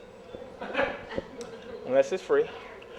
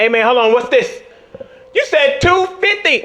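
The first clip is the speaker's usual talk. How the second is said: loud complaint climbing high; indignant protest; 7 semitones higher, 9 dB louder than usual. Acted. Comedian to promoter who underpaid him, loud indignant protest.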